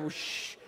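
unvoiced hissing whoosh of hairspray; whispered whoosh. Preacher mimicking a can of hairspray, airy whoosh. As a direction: breathe out a spray sound.

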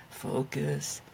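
low, growled demon teacher voice; a stern demon teacher. Pretend. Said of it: "in a demon voice?".

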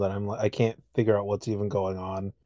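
normal talking voice

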